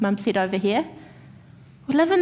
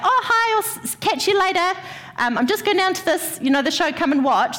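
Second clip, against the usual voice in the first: high, bright mock greeting of roommate; roommate Laura greeting; 7 semitones higher than usual. Roommate Laura passing in the hall, cheerful mock greeting. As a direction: chirp it like a breezy new roommate. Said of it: "she was like".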